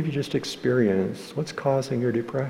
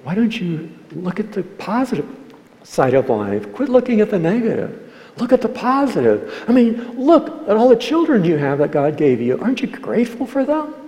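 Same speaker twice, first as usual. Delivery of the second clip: much higher and louder, a hammed-up nagging cheerfulness; mock cheery lecture; 10 semitones higher, 6 dB louder than usual. Preacher mocking advice to a depressed person, hammed-up nagging. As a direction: parody a pushy look-on-the-bright-side adviser. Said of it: "If you say".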